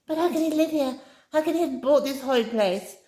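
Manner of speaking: high, loud, pained crying out; genuine anguish. A narrator's reading. Amy cries out in anguish about Flip's house. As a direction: plead in real distress. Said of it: "she cried in genuine anguish".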